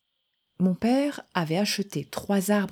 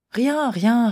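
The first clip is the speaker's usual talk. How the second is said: put-on boy's quick denial; 6 semitones higher, 5 dB louder than usual. Boy denies hiding something.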